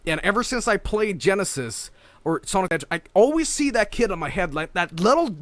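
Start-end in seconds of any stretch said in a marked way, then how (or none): 4.7-5.4 building from high rant to loud yell; hammed-up exasperated fury; ranting outburst. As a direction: let the grudge boil over. Ranting climax about hated kid.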